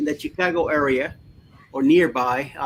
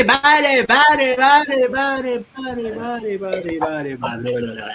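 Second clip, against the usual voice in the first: echo effect; 7 semitones higher, 5 dB louder than usual. Pretend. Mock echo after greeting.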